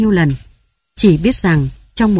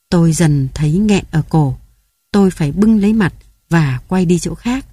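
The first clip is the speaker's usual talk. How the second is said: plain reading voice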